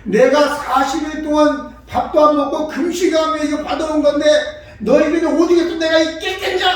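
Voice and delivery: loud, high-pitched bitter grievance; acted aggrieved reproach; self-pitying resentment. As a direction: complain like someone whose sacrifice went unthanked. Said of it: "자조 섞인 원망이 아니고요".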